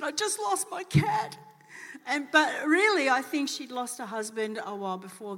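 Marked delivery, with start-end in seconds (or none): 0.0-1.4 tearful confession. Retold or imitated. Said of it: "And she burst out crying".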